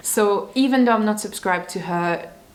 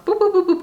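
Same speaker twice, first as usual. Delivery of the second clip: playful boops; 13 semitones higher, 5 dB louder, faster than usual. Retold or imitated. Clicking through videos in order.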